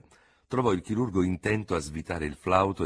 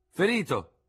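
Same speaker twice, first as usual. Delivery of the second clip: high, loud one-word answer; raised voice; calling up; 14 semitones higher, 5 dB louder than usual. A narrator's reading. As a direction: shout the answer up to a window. Shouted answer to window.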